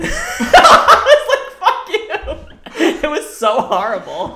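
high, loud, cracking up mid-sentence; laughing hard. Real, not acted. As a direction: squeal the words through helpless laughter. Laughing over her retold outburst.

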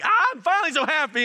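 high, loud squeal of happiness; mock delighted child. Son handed his red balloon at last, mock elation.

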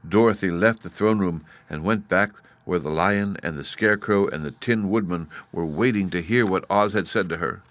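ordinary delivery, nothing marked

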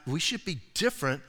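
usual speaking voice

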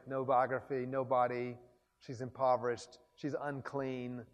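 ordinary talk

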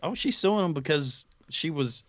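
ordinary talk, nothing marked

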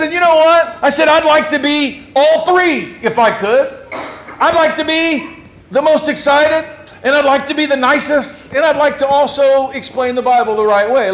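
rally the crowd with mock fervour; laughing, loud preacher's pitch; excited preaching.

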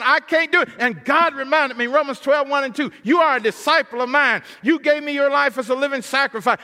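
loud, high, building preacher's cry; full-force preaching. Acted. Preacher pleads with God before the congregation, loud impassioned preaching. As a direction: preach it out to the rafters, pleading then proclaiming.

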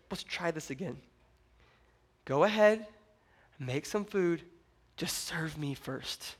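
soft, low and patient; quiet, kindly second chance; gentle coaxing. Acted. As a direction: coax softly, leaving room between phrases. Elijah gently gives another chance.

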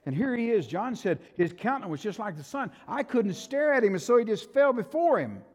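loud, high-pitched preacher's storytelling; full-force preaching. A narrator's reading. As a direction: tell it with rising awe and force.

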